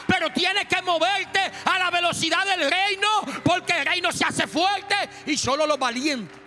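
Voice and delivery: high, loud, driving sermon; fiery pulpit delivery, shouting the kingdom message; preaching at full force. Acted. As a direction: preach it hard to the congregation.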